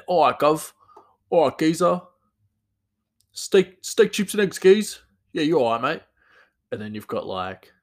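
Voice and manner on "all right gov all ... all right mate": high, loud Cockney geezer; matey Cockney, loud and high; Cockney accent. Shown as a demonstration. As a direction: belt out a chirpy Cockney greeting, pitched up. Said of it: "the, the Cockney".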